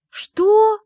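very high, loud cry of disbelief; shocked. Acted. Bride learning she'll be queen, shocked cry. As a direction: gasp it out in shock. Shocked to become ruler's wife.

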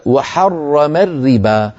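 slow recitation, thick rolled r in حرم, thin one in الربا; dark r in حرم, light r in الربا; heavy then light r. Shown as a demonstration. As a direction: recite وحرم الربا with contrasting doubled r sounds. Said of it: "الأولى بحاجة إلى تفخيم".

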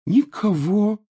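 put-on high, mournful ghost-girl voice; eerie ghost child, sad. A narrator's reading. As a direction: sigh it like a lonely ghost. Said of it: "грустно пощипывая подбородок".

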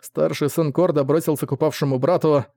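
ordinary delivery, nothing marked

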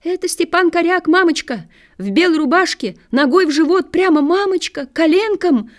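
put-on high, thin girlish reporting; high-pitched, insistent little commentary; Ляля's little voice. A narrator's reading. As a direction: pipe up to mother in a small high voice. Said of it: "Лялин голосок точит".

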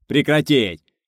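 angry officer's yell; bark. A narrator's reading. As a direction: snap the order at the men. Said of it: "рявкнул командир".

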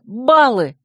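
excited exclamation. A narrator's reading. Heroine races round the room remembering points, excitedly. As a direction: exclaim it as a sudden realisation. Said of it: "Возбуждённая подскочила".

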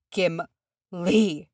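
slow, broken rasp of a name; strained, halting rasp. A narrator's reading. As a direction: rasp it out in shock. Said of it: "she rasped".

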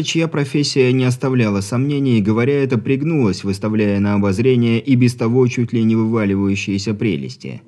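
ordinary delivery

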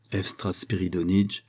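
ordinary delivery, nothing marked

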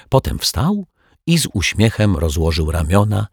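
usual speaking voice

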